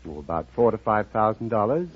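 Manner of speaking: ordinary talk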